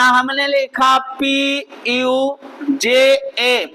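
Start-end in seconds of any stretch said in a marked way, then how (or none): 1.2-3.7 slow, letter by letter; slow spelling. Shown as a demonstration. Teacher spelling Puja for pupils, slowly. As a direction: spell it out patiently. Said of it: "long sound लिखना".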